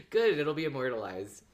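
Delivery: ordinary delivery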